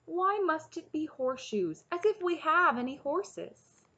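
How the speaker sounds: high-pitched complaint, pushed harder at the end; crying out in protest. A narrator's reading. Taryn complaining to Cole about horseshoes, crying out. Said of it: "cried Taryn".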